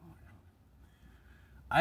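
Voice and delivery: breathless, near-silent gasp of the words; a stunned whisper; whispered oh my God. Real, not acted. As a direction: let it out on pure breath, no voice. Opening exclamation, breathy.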